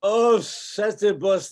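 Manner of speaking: a loud, high gasp of wonder; awed cry. Acted. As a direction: cry out to your god in amazement. Caliban awed by the spirits.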